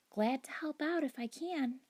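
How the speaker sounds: high squeaky hamster voice; hamster squeak. Acted. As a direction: squeak it eagerly. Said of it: "I squeaked to her".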